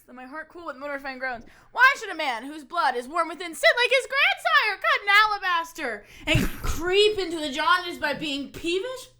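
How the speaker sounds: loud, full stage delivery; dramatic monologue. Shown as a demonstration. Actor performing the monologue for a friend, projecting loudly. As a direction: declaim it to the back row. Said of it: "Here's how I would do this one".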